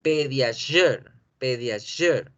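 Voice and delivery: PEDIASURE slowly, as "pedia-zhur"; pronunciación en inglés. Shown as a demonstration. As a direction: say PEDIASURE with a buzzing zh. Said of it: "esa es la pronunciación". (PEDIASURE lento, con "yur" vibrado)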